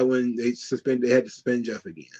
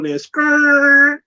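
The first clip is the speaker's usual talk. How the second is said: skidding noise; 11 semitones higher, 6 dB louder than usual. Retold or imitated. Sound of sudden stop.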